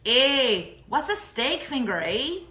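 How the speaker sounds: lightly put-on Canadian accent ending on eh; a hint of Canada, asking with a tag eh; mock Canadian accent. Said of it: "I felt like it was more Canada".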